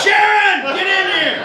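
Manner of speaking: calling the healer in a panic; mock shout for help. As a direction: yell her back into the room. Calling healer while dogs interfere.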